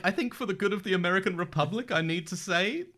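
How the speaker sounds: high, loud, strained hesitant impression; Greg. Imitating Greg objecting to Connor's presidency, halting high mock plea.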